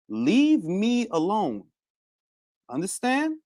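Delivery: slow, drawn-out warning. Pretend. Streamer telling a rival to back off, slow warning. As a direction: stretch each word out as a firm warning.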